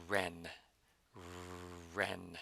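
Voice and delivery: wren with rounded lips, English R; English-style wren, lips close. Shown as a demonstration. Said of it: "If I pronounce this in the English way".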